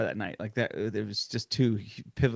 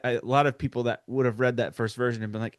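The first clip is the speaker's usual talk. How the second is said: ordinary talk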